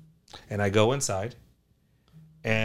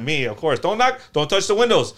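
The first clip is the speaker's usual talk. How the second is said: much higher and louder, a fussy shopkeeper's alarm; mock scolding; 8 semitones higher, 10 dB louder than usual. Shop owner mock-warning the priest off his windows, loud and fussy. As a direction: shriek the shop rule in jest.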